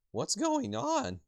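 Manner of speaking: high, drawn-out bewildered question; bewildered game character. Acted. Character confused at silent friends.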